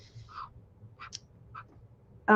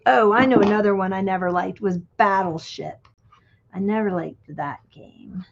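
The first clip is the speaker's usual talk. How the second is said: ordinary talk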